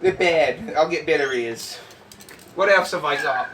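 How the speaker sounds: ordinary delivery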